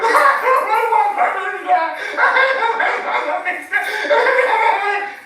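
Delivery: long high laughter. Real, not acted. Laughs at the angel story.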